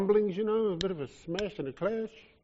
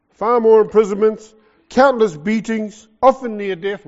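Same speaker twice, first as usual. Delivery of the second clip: loud, high, fiery reading; preaching loud; 4 semitones higher, 12 dB louder than usual. Real, not acted. Preacher to congregation, reading Paul's sufferings at full force. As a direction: proclaim each hardship with force.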